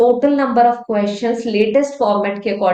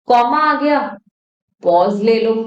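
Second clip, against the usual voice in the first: ordinary talk, nothing marked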